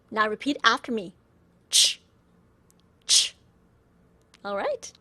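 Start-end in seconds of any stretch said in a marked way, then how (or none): 1.7-3.4 ch alone, as in church; pinyin ch sound. Shown as a demonstration. Teacher leading repetition, a bare ch as in church. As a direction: release a voiceless ch with no full vowel. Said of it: "just like C H in church".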